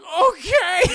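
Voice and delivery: high, loud wail of agreement; hammed-up distressed compliance; acted panic. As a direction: give in with a mock wail. Frightened child forced to help.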